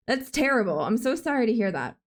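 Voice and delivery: ordinary talk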